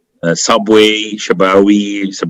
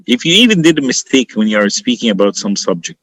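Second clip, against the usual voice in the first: ordinary delivery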